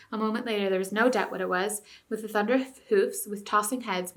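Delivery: ordinary delivery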